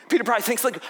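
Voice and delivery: normal talking voice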